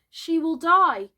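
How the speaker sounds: high, loud, slow alarm; drawn-out dramatic alarm; dire warning. A narrator's reading. Boy fears Wendy will die.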